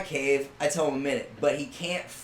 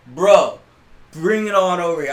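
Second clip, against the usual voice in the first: loud call; 8 dB louder than usual. Retold or imitated. Kid calling the dare on.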